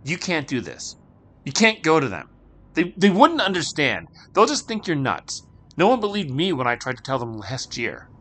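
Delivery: put-on urgent boy. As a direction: plead with her to stop. Christopher urgently warns Marie.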